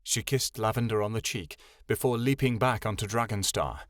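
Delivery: usual speaking voice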